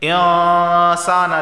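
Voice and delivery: hidden noon held long and nasal; ikhfa ghunna in insana. Shown as a demonstration. Teacher showing tongue doesn't touch, long ikhfa ghunna in insana. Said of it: "ज़बान के नोक ऊपर के तालू से नहीं लग".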